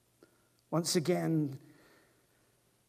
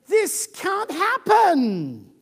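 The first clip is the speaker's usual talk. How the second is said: This can't happen, drawn out, louder; slow emphatic disbelief; 11 semitones higher, 9 dB louder, much slower than usual. Acted. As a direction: stretch every word in protest. Sarah's climax, slow and loud.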